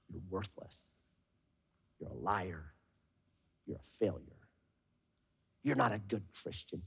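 the enemy hissing in your ear; accusing whisper. Acted. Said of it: "He loves to whisper into your ear".

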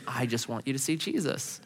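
low, very soft sincere voice; gentle plea. Retold or imitated. Moses to the waitresses, gently earnest. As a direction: softly imitate a gentle evangelist.

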